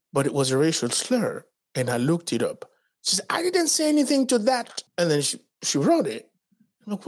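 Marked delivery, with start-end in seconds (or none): 3.3-4.8 squeaky innocent voice; high mock voice of a coworker playing innocent; high mock denial. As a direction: pitch it up like a coworker denying it.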